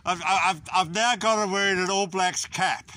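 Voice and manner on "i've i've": ordinary talk, nothing marked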